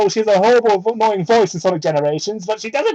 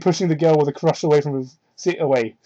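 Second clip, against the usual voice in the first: ordinary talk, nothing marked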